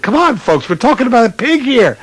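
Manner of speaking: amused disbelief. Retold or imitated. Rookie urging the whole meeting to lighten up, incredulous. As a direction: break the tension, incredulous.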